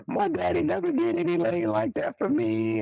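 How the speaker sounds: high imitation of a tearful young woman; imitating the girlfriend. Cousin's girlfriend quoted crying.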